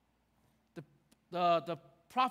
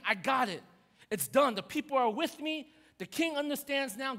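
high, mildly excited imagined victory cry; triumphant; 5 semitones higher, 4 dB louder than usual. Retold or imitated. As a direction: voice his triumph, pitched up. Prophet's imagined triumph after the miracle.

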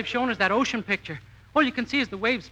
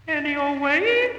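echo; 6 semitones higher, 4 dB louder, slower than usual. Acted. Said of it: "Rochester, that echo again".

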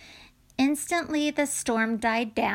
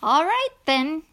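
Jesus calling back; 6 semitones higher, 7 dB louder than usual. A narrator's reading. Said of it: "Jesus answered him".

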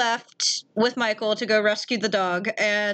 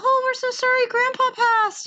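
high-pitched, gushing sympathy; hammed-up sorrowful fussing; mock condolence; 13 semitones higher than usual. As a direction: offer exaggerated condolences up high. Retelling their condolences to grandma.

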